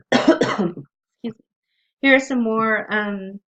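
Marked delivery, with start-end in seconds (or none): none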